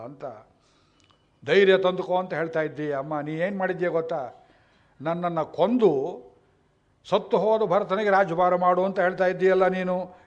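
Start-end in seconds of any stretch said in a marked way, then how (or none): 1.5-10.2 Bharata rebuking mother. Acted.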